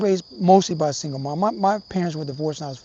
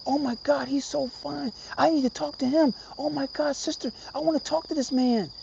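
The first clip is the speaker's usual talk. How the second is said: higher-pitched imitation of gushing, without the volume; half-hearted yell, pitched up and smitten; mock excited yelling; 7 semitones higher, 6 dB quieter than usual. Sister gushing to her sibling about a man, mock excited yelling. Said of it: "she was yelling out".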